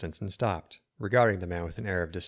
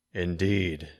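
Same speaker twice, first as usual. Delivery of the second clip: sinister wizard. Acted. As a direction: speak like a cold dark wizard.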